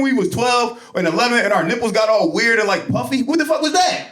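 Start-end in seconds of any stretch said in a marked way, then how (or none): 3.2-4.1 high, incredulous squeak; higher pitched disbelief; baffled. Acted. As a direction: sound utterly confused by your own body. Reliving puberty confusion.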